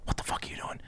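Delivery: whispered disbelief; retold stunned whisper. Finding roommate in his bathroom, stunned whisper. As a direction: drop to a bewildered whisper.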